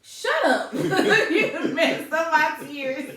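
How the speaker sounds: loud, high laughing scold; laughing protest. Real, not acted. Wife scolding husband for the joke, laughing loudly. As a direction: laugh while telling him off.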